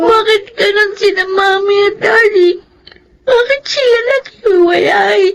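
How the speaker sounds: high, crying questions; tearful child. Acted. Girl asking her nanny through tears. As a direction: sob out the questions. Child asks why parents separated.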